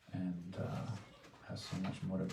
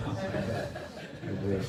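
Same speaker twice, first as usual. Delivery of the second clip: laughing; 4 dB louder than usual. Real, not acted. Laughing at her own joke with friends, laughing. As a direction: say it through a real laugh. Repeats the wish while laughing.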